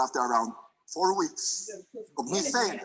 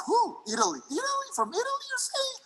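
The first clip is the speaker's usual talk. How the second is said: disbelief. Retold or imitated. Disbelief at a message from Italy.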